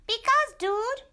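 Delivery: a tiny excited dog greeting his friend; squeaky little dog. Acted. Poquito Tito greeting Scapito, high squeaky voice.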